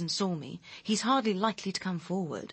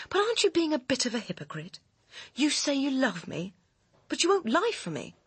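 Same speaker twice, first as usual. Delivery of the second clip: higher and higher, voice climbing in reproach; rising accusation; 7 semitones higher than usual. Acted. Bitter reproach to lover.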